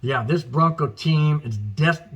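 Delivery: usual speaking voice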